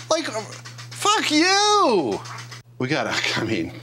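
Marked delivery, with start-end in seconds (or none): 0.1-2.2 much higher, slow snickering taunt; the dog laughing at his owner's miss; mocking dog. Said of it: "and he's like".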